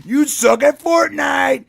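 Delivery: loud, slow teasing jeer; taunting shout. Retold or imitated. Boy laughing off the insult to his friend, loud slow jeer. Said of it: "he goes".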